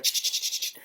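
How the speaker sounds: unvoiced scratchy ch-ch-ch of a dog digging; digging noise. Speaker describing squirrels digging, unvoiced digging noise. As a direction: mimic frantic scrabbling.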